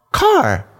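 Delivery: very high, questioning little voice; dad imitating his son asking about the car; toddler asking. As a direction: ask like a curious toddler. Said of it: "he was like".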